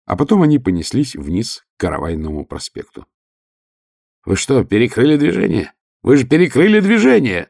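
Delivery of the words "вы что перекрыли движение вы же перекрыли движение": yelling over the wind; вопль сквозь ветер. Acted. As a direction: shout it into a roaring wind. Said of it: "завопил он сквозь ветер". (вопит, перекрикивая ветер)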